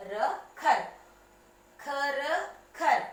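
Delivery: "khar" said slowly and separately; careful khar. Shown as a demonstration. Teacher sounding out the first syllable for children. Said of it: "So how to pronounce kh".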